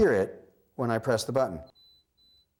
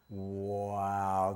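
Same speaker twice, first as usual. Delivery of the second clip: low, hushed, drawn-out wow of wonder; amazed wow; 4 semitones lower, 3 dB quieter than usual. Shown as a demonstration. Showing a child how to react to the beep, hushed amazed wow. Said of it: "as soon as I say".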